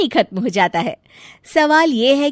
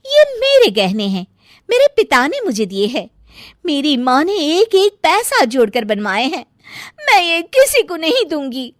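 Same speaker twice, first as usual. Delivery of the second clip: put-on higher, protesting young wife voice; defiant bride; 6 semitones higher, 4 dB louder than usual. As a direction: refuse firmly in a raised pitch.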